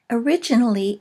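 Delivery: usual speaking voice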